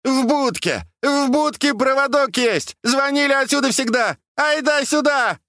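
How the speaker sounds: very high, frantic hollering; high-pitched urgent shouting; yelling out loud. A narrator's reading. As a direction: bawl directions across the water. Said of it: "И заорал в голос".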